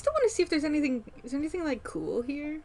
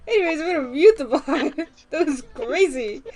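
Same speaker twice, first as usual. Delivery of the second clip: laughing; 7 dB louder than usual. Real, not acted. Laughing after loud noise.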